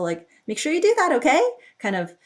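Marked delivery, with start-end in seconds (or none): 0.5-1.4 light cutesy nudge, pitched up; a sweet playful reminder, rising on okay. Shown as a demonstration. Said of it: "cutesy tone particle".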